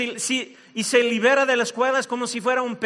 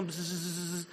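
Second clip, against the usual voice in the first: quiet vacuum cleaner buzz; vocal imitation of clippers; imitated buzz; 4 semitones lower, 14 dB quieter than usual. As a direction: make a soft buzzing noise. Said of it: "un sonido como".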